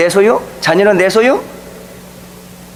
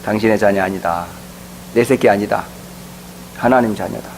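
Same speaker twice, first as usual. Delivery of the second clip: lower, softer, deliberate statement; firm declaration; 9 semitones lower, 7 dB quieter than usual. Retold or imitated. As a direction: lay it down quietly but firmly. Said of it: "분명히 얘기했어요".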